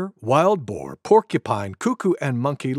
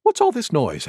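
lightly voiced deer, pitched up; Deer's query; 7 semitones higher than usual. A narrator's reading. Deer coming upon the noise, higher questioning voice. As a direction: ask higher, a touch put out. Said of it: "asked Deer".

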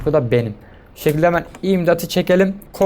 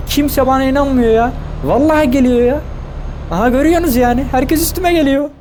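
exaggerated complaint; 9 semitones higher than usual. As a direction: wail that everyone's out to get you. Comic complaint that everyone targets him.

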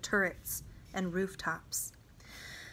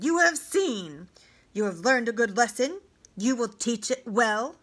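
raised, forceful commander's voice; commanding officer; 5 dB louder than usual. Acted. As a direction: lecture the captives loudly with a conqueror's authority. Commander lectures the watching captives.

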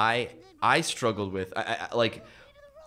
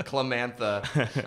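usual speaking voice

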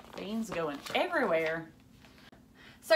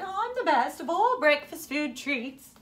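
higher, boastful sing-song brag; bragging; 7 semitones higher, 5 dB louder than usual. A narrator's reading. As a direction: show off like the champion. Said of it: "She bragged".

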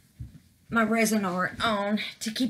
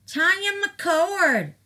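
higher, drawn-out sing-song call of the name; excited call-out; 8 semitones higher, 3 dB louder, slower than usual. Real, not acted. Reading a subscriber's name to her viewers, excited call-out.

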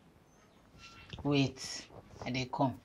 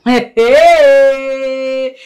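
high, loud, drawn-out "hey"; loud exclamation; 6 semitones higher, 8 dB louder than usual. Acted. Woman reacting to a man's clothes not fitting, loud exclamation. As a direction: stretch the cry out high in mock astonishment.

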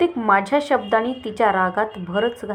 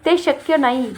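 a raised, higher angry cry; shouting again; 5 semitones higher, 4 dB louder than usual. A narrator's reading. Leela refuses to wait an hour, raised angry voice. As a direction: snap it out louder, refusing. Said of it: "ती पुन्हा ओरडली".

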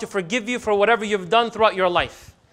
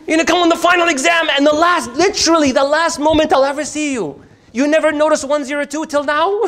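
higher, louder exasperated complaint; indignant outburst; 7 semitones higher, 5 dB louder than usual. Retold or imitated. Student telling off the professor, loud and indignant. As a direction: let the disbelief burst out.

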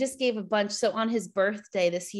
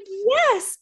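very high, louder squeal of a yes; thrilled yes; 13 semitones higher, 6 dB louder than usual. Retold or imitated. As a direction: burst out with pure joy. She confirms wanting a girl.

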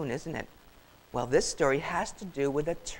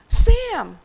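high, loud cry of shock; loud, high horrified shout; alarmed cry; 12 dB louder than usual. Acted. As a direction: call out the name in alarm. Gasp, sister spots fallen brother.

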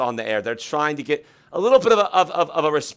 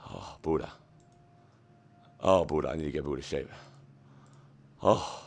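out of breath; 12 semitones lower, 12 dB quieter than usual. Real, not acted. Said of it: "out of breath right now".

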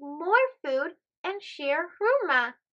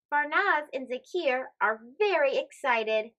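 plain reading voice

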